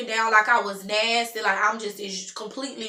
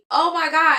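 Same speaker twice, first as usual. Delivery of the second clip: loud, high gasp of shock; shocked oh my God; 6 semitones higher, 6 dB louder than usual. Retold or imitated. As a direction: blurt it out stunned. Cop warns of jail.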